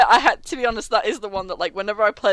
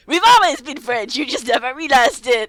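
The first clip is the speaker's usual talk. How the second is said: put-on French accent; 3 semitones higher, 7 dB louder than usual. Townsfolk insisting to players they're French, loud French accent. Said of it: "Everyone's fucking French".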